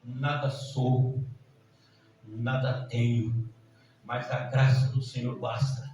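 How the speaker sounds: slow, low, measured declaration; solemn confession. Real, not acted. Preacher humbly confessing before the congregation, solemn.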